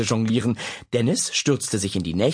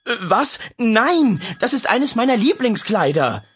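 startled refusal; 11 semitones higher, 5 dB louder than usual. Acted. Mother startled refusing daughter.

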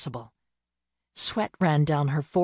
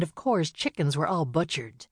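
ordinary delivery

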